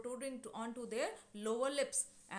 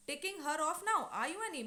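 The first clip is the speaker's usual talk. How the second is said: sharp retort; 6 semitones higher, 4 dB louder than usual. Acted. Husband retorts at quarrelling wife.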